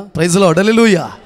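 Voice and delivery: two loud praise shouts; rally the congregation loudly; congregation call. Real, not acted. Calling congregation to respond. (గట్టిగా స్తుతి నినాదాలు)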